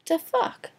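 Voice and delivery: high incredulous cry; can't believe it; real outraged disbelief; shocked. Real, not acted. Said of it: "reacts to mom saying yes".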